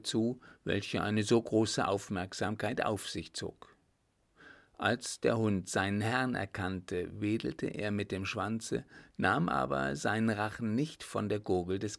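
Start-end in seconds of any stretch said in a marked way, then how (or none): none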